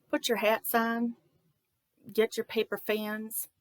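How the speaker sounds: ordinary delivery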